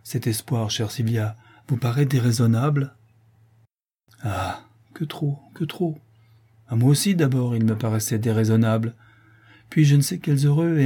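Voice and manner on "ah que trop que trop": slow, breathless whisper of regret; despairing whisper. Acted. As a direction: let it barely escape, slowly. Sylvia's despairing reply.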